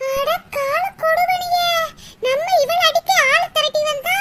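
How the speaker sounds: wailing lament. Acted. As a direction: lament being beaten up. Lamenting after being beaten. (ஒப்பாரி போல அலறல்)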